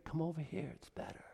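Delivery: soft, high, airy tempter fading into a whisper; sly devil whispering; Satan's whisper. Acted. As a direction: lure them in with a whisper. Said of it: "he whispers in their ear".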